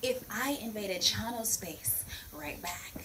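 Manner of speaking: whispered aside. Acted. Whispered lead-in to demo.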